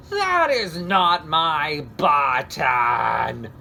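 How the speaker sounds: slow, loud wail. Acted. Toad wailing at raccoon's wrong button, wailing. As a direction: stretch it into a wail. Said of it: "wailed Toad".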